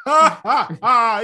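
high, staccato mock laugh; imitated dying laugh. Retelling the character laughing as he's killed, imitated laugh. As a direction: act out a defiant laugh. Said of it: "laughs as he's killed".

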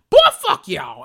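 loud, fed-up blast at the network; loud, disgusted dismissal; exasperated outburst. Real, not acted. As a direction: throw it at them, loud and done. Angry at network using [name].

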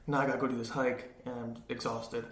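normal talking voice

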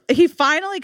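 ordinary talk, nothing marked

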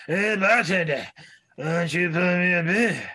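put-on taunting drunk at a pool table. Voicing drunk guy at bar.

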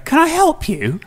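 high mock cleaner asking. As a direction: play the puzzled cleaner at the door. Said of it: "the cleaner's just going".

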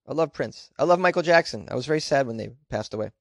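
usual speaking voice

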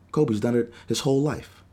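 normal talking voice

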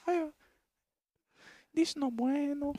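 high, slow mock Mexican accent. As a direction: play it up as a broad Mexican accent. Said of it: "He's like".

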